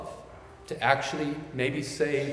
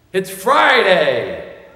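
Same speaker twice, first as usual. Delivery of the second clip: long, drawn-out, much louder and higher "It's Friday!"; preacher's shout; 7 semitones higher, 12 dB louder, much slower than usual. Retold or imitated. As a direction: bellow it slowly like a pastor's climax. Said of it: "the pastor shouts out".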